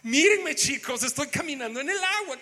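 thrilled call for everyone to look; excited Peter. Acted. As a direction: show off excitedly, pitch riding high. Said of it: "Pedro ha de haber dicho".

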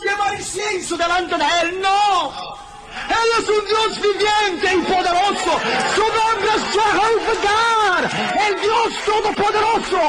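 high, slow, fervent preaching; ringing pulpit declaration; preaching at full force. Acted. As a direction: proclaim God's might from the pulpit.

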